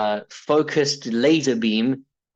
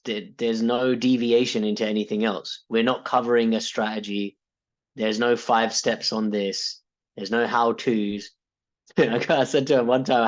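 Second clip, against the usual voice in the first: usual speaking voice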